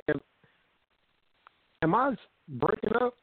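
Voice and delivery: ordinary talk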